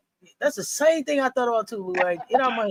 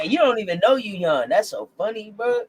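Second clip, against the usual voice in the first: ordinary talk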